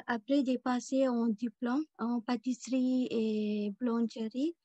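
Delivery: ordinary delivery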